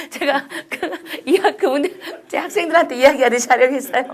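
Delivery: talking through amused chuckles; laughing. Real, not acted. Lecturer amused at addressing listeners like students, laughing.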